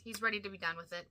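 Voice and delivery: ordinary talk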